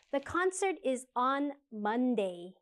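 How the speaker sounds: spoken slowly for learners; slow model answer. Shown as a demonstration. Teacher reads the answer slowly for students. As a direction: drill it slowly and clearly. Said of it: "what are the questions for these answers".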